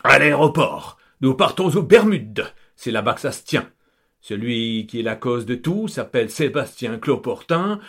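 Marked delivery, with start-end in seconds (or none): 0.0-2.4 loud exclaiming, higher; count exclaiming. Acted. Count overruling his wife, loud exclamation. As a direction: cry out the new plan. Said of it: "s'écrie Gonzague".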